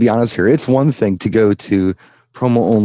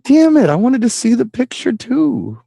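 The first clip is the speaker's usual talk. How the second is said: high-pitched, hammed-up grumble; mock whining; 13 semitones higher than usual. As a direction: whine playfully about being left out. Voices own mock complaint about photos.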